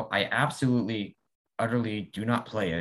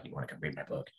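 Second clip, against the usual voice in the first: lower, soft, eager offer; soft pushy offer; 6 semitones lower, 11 dB quieter, faster than usual. Retold or imitated. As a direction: murmur it like a sly invitation. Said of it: "I'll be like".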